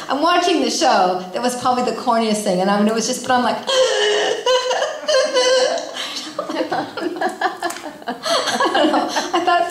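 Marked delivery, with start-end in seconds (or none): 3.7-8.7 high, helpless burst of laughter; long real laugh. Real, not acted. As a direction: laugh long at yourself, unable to stop. Laughing at her own tears.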